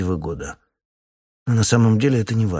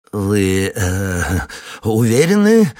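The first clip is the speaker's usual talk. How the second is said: bleating ringmaster; 5 dB louder, slower than usual. A narrator's reading. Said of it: "блеет Шпрех".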